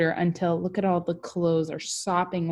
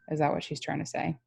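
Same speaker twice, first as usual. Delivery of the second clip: ordinary talk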